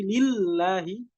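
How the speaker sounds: "lillahi" with weight on the doubled l; stressed doubled lam. Shown as a demonstration. Teacher showing the stressed lam in "lillahi". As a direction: press the shadda in "lillahi", slowly. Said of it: "Harus diberikan nabroh".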